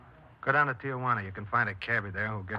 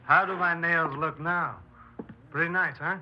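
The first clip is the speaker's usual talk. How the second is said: slow, higher, showing off; vain preening; 5 semitones higher, 6 dB louder, slower than usual. Acted. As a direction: savor it like admiring yourself. Bill preens over his nails.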